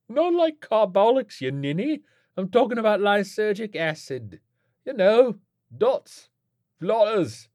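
high-pitched, teasing rogue; cheeky druggie mate. Acted. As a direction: explain it as if to a silly mate.

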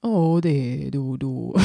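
slow, lightly done Värmland dialect; Värmland accent. Shown as a demonstration. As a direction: try out a Värmland accent, drawn out. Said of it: "hur pratar man i Värmland?". (lätt värmländsk dialekt)

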